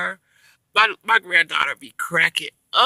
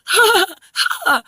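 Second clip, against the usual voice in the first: put-on high, loud gasping baby ha-ha; exaggerated squealed laugh in imitation; imitated baby laugh; 13 semitones higher, 8 dB louder, slower than usual. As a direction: mimic a baby's big laugh. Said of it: "Your daughter laughs just like how you did".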